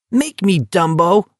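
high, shrill mocking cry; shrill taunt. A narrator's reading. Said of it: "Barkovich shrilled".

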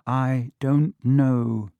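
ordinary delivery, nothing marked

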